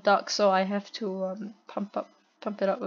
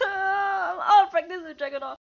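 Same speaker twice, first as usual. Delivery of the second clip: high-pitched real whine; wailing lament; 9 semitones higher than usual. Real, not acted. Gamer lamenting poor Dragunov skills, high wail. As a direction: wail it in exasperation. Laments own bad play.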